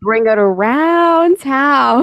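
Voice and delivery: lightly voiced SpongeBob. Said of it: "Yes, yes, SpongeBob".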